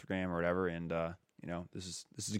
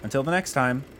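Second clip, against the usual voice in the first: upbeat, louder announcer sign-off; podcast outro read; 6 semitones higher, 6 dB louder than usual. Acted.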